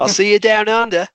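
loud, high put-on Aussie accent; mock Australian farewell. Classmates seeing off the Australian student, mock Australian. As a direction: call it out in jokey Australian. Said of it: "What if she got so frustrated".

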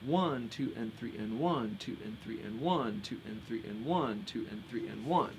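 steady rhythmic count, weight on "one"; counting in three. Shown as a demonstration. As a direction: lean on each "one", drop away on "two, and three". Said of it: "playing a very simple pattern".

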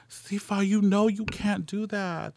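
high-pitched, gently admonishing aunt voice; consoling aunt. Retold or imitated. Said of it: "like, rubbing my back".

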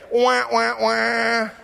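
mock sad-trombone "wah, wah, wah"; voiced wah-wah of a failed joke. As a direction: hum a deflating sad-trombone tag. Mocking Nathanael's put-down.

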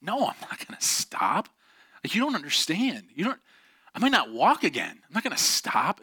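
acted, emotional refusal with swooping pitch; defiant protest. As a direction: re-enact a stubborn child arguing back. Acted argument with crying mother.

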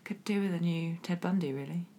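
conspiratorial whisper; breathy secret whisper. Acted. Mock confession of a dark wish.

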